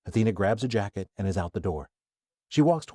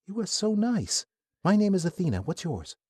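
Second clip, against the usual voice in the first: put-on friendly young woman; 11 semitones higher than usual. Athena introducing herself to Kai, lightly voiced friendly. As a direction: introduce yourself sweetly.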